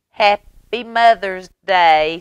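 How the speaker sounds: put-on the kids chanting it together; bread slices' greeting. The two slices of bread greeting Mama, sing-song. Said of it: "said the two slices of bread".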